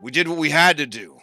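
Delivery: solemn Pythor. Acted. Pythor addressing the party about his people, solemn. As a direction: weigh each sentence with regret.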